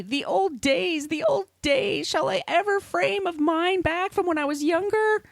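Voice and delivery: hammed-up moaning diary voice; whiny Dickens. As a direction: whine it like a self-pitying old man pining for youth. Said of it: "He's just complaining".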